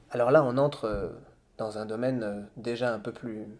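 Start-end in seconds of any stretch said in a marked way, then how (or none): none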